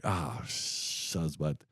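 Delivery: mild mock mutter on being seen crying; embarrassed groan. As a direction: mutter it under your breath, caught out. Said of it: "I'm like".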